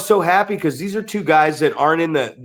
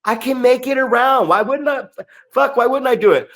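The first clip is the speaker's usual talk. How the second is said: mocking student; 8 semitones higher than usual.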